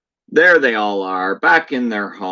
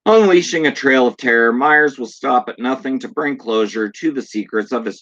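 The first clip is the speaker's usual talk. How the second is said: trailer voice; 5 semitones higher than usual. Acted. Fan reading the box blurb to viewers, dramatic trailer read. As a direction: punch it like a horror trailer announcer.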